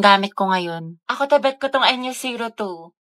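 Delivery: ordinary talk, nothing marked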